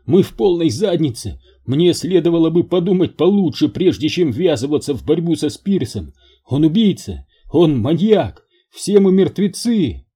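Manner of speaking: high, loud rising panic; panicky. Acted. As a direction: spiral into panic. Major on edge of panic.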